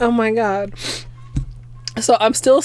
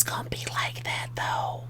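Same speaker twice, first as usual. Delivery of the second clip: awed whisper; 13 dB quieter than usual. Real, not acted. Streamer whispering to chat in awe. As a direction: breathe it out, hushed and amazed. Hushed aside after the shock.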